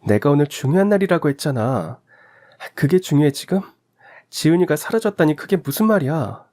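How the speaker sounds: a busy wife snapping, then caught off guard; annoyed wife on phone. Acted. (바빠서 짜증 내다 놀라는 아내)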